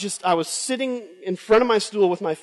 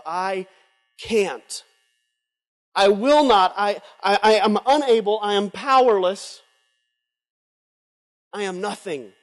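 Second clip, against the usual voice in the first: desperate confession; slower than usual. Retold or imitated. Voices the moment of surrender.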